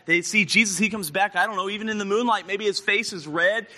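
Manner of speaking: ordinary talk, nothing marked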